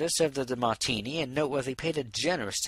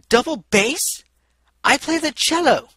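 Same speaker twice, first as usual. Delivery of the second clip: much higher, loud and aghast; indignant outburst; 10 dB louder, slower than usual. A narrator's reading. Mayor indignantly corrects the stallion's insulting mistake, loudly. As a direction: explode in offended disbelief. She whirled around, aghast.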